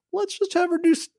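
high-pitched mock-breezy pitch; mocking writers. Mimicking the writers pitching lazy telepath stuff, mocking. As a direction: make fun of it in a high, airy voice.

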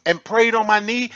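ordinary delivery

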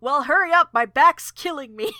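hammed-up griping, thrown out loudly; Roanoke. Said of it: "And then Roanoke".

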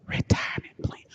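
breathy seductive whisper; mock seductive whisper; sultry whisper. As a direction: whisper it like a come-on.

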